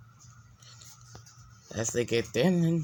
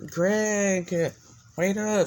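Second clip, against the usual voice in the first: loud, higher call down the hall; 9 semitones higher, 5 dB louder, much slower than usual. Acted. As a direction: shout after him to wait up. Girl calls after him in hall.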